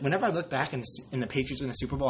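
normal talking voice

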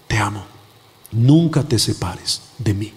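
gentle and grave, taking time over each phrase; tender reassurance. Retold or imitated. Preacher voices the Lord answering him, low tender reassurance. Voicing the Lord's reply.

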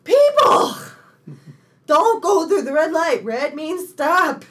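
loud, high yell, slowing on the last words; yelling at drivers. Retold or imitated. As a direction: shout it at bad drivers through the windshield. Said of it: "I'm like".

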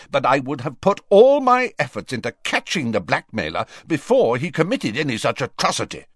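heated self-justification; argumentative. A narrator's reading. Quarrel between the two ministers.

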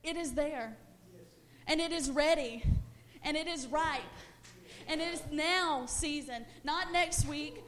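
loud, rising preacher's call to action; full-force preaching. Acted. Preacher rallying the church to action, shouting over music. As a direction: whip the church up, building.

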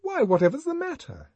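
high, startled question; suddenly alarmed nurse. Acted. Nurse startled by his face.

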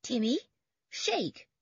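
high, separated two-word order; command. A narrator's reading. George orders Timmy to shake paws, commanding. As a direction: command your dog, pausing after the name. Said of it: "commanded George".

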